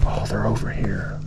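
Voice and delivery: hushed, close to the mic so the fish won't spook; talking low. Real, not acted. Angler near the fish, talking low to viewers. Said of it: "Why am I talking low?".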